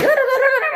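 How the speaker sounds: sudden high laugh. Real, not acted. Laugh after ranting.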